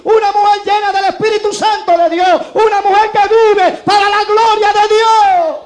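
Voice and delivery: fervent preaching. Acted. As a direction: proclaim it to the whole church, building to a roar. Predica con toda la fuerza. (predicación ardiente que va subiendo hasta gritar)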